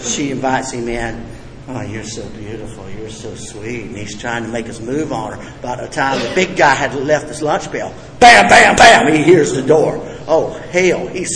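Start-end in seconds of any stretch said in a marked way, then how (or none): 1.7-3.9 mock sweet talk. Alcoholic sweet-talking the girlfriend. 8.2-9.1 loud, higher banging "bam, bam, bam"; imitated door knocks. Storyteller mimics the husband knocking, loud imitated bangs.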